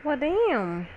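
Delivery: usual speaking voice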